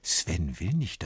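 plain reading voice

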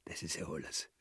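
tonlos, ganz leise; Flüstern. Acted. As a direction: beiseite flüstern. Leise Bemerkung beiseite. (leise geflüstert)

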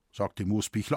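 ordinary delivery

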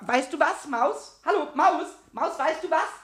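high, excited stammering promises; eager coaxing. Acted. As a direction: bargain eagerly with a shy mouse. Promising treats to lure the mouse. (aufgeregt lockend)

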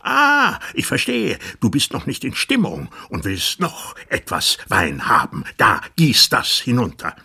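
loud, mocking rage; raging tyrant. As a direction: sneer and shout at him.

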